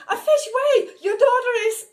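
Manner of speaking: high, loud call, I forgive you no longer, just wait; shouting after him. Retold or imitated. Calling after the departing spirit, a loud high shout. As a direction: call out to stop someone leaving. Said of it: "I shouted at him".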